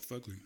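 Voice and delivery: Fugly said softly as FUG-lee; Fugly with the uh of "fudge"; preferred pronunciation. Shown as a demonstration. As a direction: say Fugly with a short uh. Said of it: "what I like to call it".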